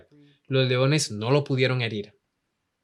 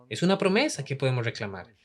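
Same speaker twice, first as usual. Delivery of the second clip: ordinary delivery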